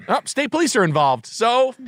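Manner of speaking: high-pitched mock-smug threat; vindictive teacher. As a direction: crow it like a petty teacher. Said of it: "a teacher would".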